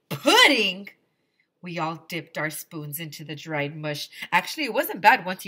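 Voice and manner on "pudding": loud astonished question; can't believe it; disbelief. Acted. Kids disbelieving.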